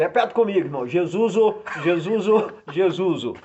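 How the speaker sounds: JESUSO with an extra O on the end, loud and high; loud call-out, "Jesuso" for Jesus; imitated mispronunciation. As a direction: lead a chant of "Jesuso", imitating the preacher. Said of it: "ele falava assim".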